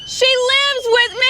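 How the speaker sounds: high, loud disbelief; shocked exclamation. Real, not acted. Telling viewers the crush's girlfriend is her roommate, high shocked outburst. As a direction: blurt it out in shock.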